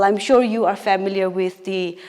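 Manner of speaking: ordinary delivery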